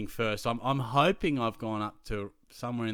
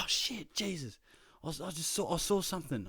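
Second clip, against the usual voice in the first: higher, panicky mock fright; spooked kid; 7 semitones higher than usual. Scared student whispering to his teacher in woods. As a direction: act out a kid who just saw something.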